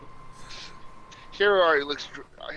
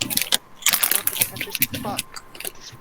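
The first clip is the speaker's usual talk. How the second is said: muttering under his breath; slower than usual. Acted. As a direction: grumble it to yourself, barely voiced. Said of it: "Hero mutters under his breath".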